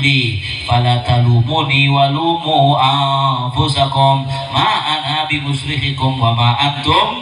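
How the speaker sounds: slow, low measured chant of scripture; Quran recitation. Real, not acted. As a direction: recite the verse slowly in a low, sustained voice.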